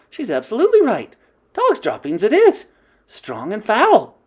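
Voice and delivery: high, loud agreement; crying out. Acted. Another witch agreeing, excited. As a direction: back her up eagerly.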